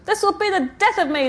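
mother crying out from the bathroom. A narrator's reading. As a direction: wail it from the next room. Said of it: "their mother cried".

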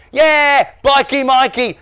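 put-on high, loud childish taunt; jeering tease. A narrator's reading. Dave teasing Norm about Mikey, jeering. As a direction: crow the silly nickname.

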